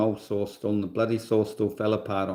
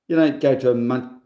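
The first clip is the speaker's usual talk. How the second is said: normal talking voice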